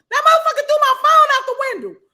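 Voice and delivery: loud, high, fast outraged telling; punchline about the thrown phone, loud and fast. Real, not acted. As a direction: fire it off in disbelief.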